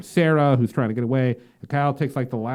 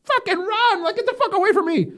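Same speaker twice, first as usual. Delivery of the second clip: very high, loud yell, laughing at the end; frantic shout to run; 5 dB louder than usual. Retold or imitated. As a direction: scream at her to get away from him. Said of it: "And she's like".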